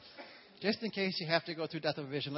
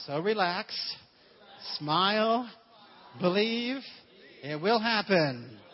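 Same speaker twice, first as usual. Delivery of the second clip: rising, louder urging to relax and believe; preaching build; 5 semitones higher, 11 dB louder, slower than usual. Acted. Preacher to congregation, building louder exhortation. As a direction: swell each word louder.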